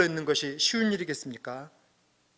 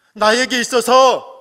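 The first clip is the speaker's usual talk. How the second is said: loud, slow, higher pulpit emphasis; impassioned preaching; 7 semitones higher, 12 dB louder, slower than usual. Acted. Pastor pressing the point on the congregation, slow and loud. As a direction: lean hard on each word.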